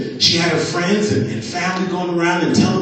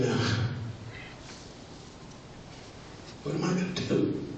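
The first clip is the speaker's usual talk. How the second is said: hushed and much softer, close to a whisper in places; quiet despair; 9 dB quieter than usual. Retold or imitated. Mom alone with the foreclosure papers, hushed and despairing. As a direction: murmur it to yourself like your livelihood is slipping away.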